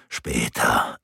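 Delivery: low, brief man's voice; curt Reinhard. A narrator's reading. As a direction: cut her off calmly. Reinhard answers curtly. (knapper, tiefer Reinhard)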